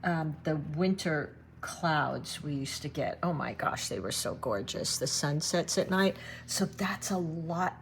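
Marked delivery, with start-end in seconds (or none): none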